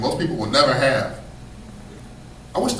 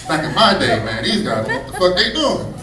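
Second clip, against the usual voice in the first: put-on higher, loud laughing old-timer rant; old athlete griping, much louder with laughter; grumpy old-timer, louder; 3 semitones higher, 7 dB louder than usual. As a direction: rant like a washed-up ex-player laughing at himself.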